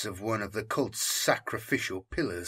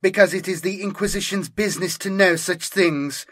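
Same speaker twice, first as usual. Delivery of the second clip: higher, louder curt retort; snapping; 7 semitones higher, 4 dB louder than usual. Acted. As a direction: put him in his place. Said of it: "Santos snapped".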